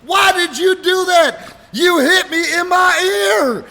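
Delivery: very loud, high, drawn-out mock wailing; imitated pained wail. Punched friend protesting the hit, hammed-up wail. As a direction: howl the complaint, dragging every word.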